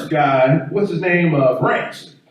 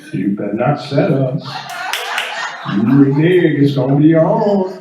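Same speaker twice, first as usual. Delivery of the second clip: lower, threatening spades partner; low mock threat over a card game; Leon trash-talking; 4 semitones lower than usual. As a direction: warn him like a serious card player. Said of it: "I want Leon to tell me".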